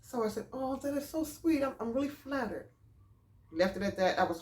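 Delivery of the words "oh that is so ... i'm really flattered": higher, softened sweet reply; retold polite gushing; politely flattered. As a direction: coo it to spare his feelings. Polite reply hiding her disbelief.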